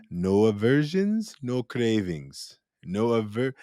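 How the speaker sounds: slow, drawn-out imitation of the meditation teacher's accent; Goenka. Quoting the retreat tapes, slow imitated teacher's accent. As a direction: stretch each word in the teacher's accent. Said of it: "He has a really interesting, like, accent".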